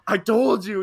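high, loud imitated yell; vindicated outburst. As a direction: crow it as the passenger proved right. Vindicated passenger in retold climax.

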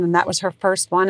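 usual speaking voice

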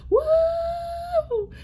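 very high, whooping holy shout; hammed-up gospel whoop. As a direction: whoop like a man caught by the spirit in church. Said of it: "or like [name]".

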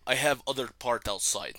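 lightly hammed-up Russian soldier accent; Nova, Russian accent. Dog reporting in to owner, mock Russian accent. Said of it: "like a fucking Russian soldier".

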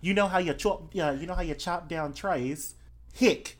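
broad Australian, punching the last word; Australian accent. Acted. Explaining the chopping sound to a listener, emphatic Australian. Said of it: "That Australian is perfect".

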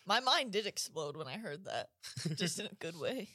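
normal talking voice

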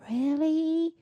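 high squeaky disbelief; a sharp rising really; incredulous. Real, not acted. Complaining to viewers about the cold, high incredulous.

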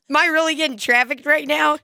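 high, amused disbelief; mock surprise. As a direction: ask it half laughing. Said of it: "And you're like".

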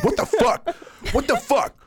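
high, laughing mock outrage; a hammed-up drunk friend yelling; mock angry outburst. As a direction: imitate her furious, laughing. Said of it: "She went gangster".

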